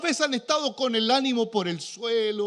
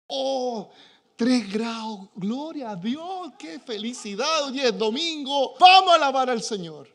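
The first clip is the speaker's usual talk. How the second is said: ironic cheerful praise, ending louder; sarcastic mock joy; 4 semitones higher, 5 dB louder than usual. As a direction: pretend to rejoice at three degrees. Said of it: "usted cree que yo me levanto así danzando". (alegría fingida e irónica)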